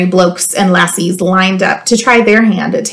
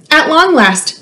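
ordinary delivery

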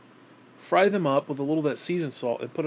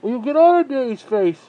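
much higher, louder scold; 10 semitones higher, 11 dB louder than usual. Real, not acted. Owner scolding the dog in his face, loud and high. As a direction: shoo the dog off loudly.

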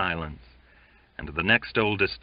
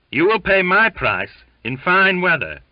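high, loud parting shout; shouted over shoulder; 11 semitones higher, 8 dB louder than usual. A narrator's reading. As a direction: shout it back as you leave. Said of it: "he shouted over his shoulder".

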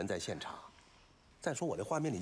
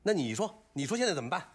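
louder, higher, exasperated challenge; exasperated demand; 7 semitones higher, 5 dB louder than usual. Acted. Director cornered by an actress refusing the scene, exasperated. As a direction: throw the question back at the actress, raised voice.

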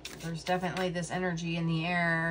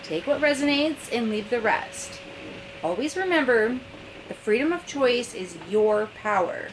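bright, louder announcer-style welcome; polished intro read; 3 semitones higher, 4 dB louder than usual. Acted. Host greeting seekers over intro music, announcer style. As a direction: read it like a produced show opener.

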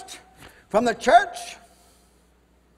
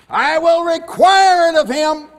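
God's warning; 5 semitones higher, 8 dB louder than usual. Acted. Preacher voicing God's warning.

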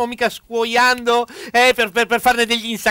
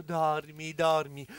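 soft lulling; 5 semitones lower, 9 dB quieter, much slower than usual. Acted. Lulling the patient to sleep.

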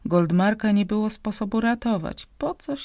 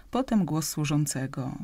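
plain reading voice

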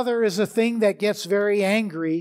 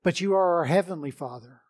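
prayer; 3 semitones lower than usual. Acted. Pastor leads opening prayer.

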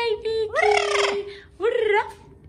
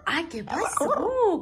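ordinary talk, nothing marked

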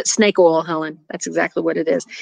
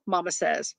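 usual speaking voice

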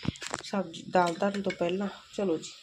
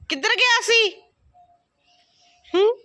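high loud questioning call; 14 semitones higher, 11 dB louder than usual. Real, not acted. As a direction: call out where he went. Scolding the returned dog. (ਉੱਚੀ ਆਵਾਜ਼ 'ਚ ਪੁੱਛਣਾ)

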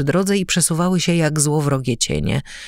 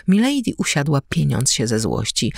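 ordinary delivery, nothing marked

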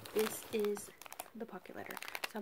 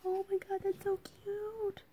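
excited squeal; 10 semitones higher than usual. Real, not acted. Excited at cute item.

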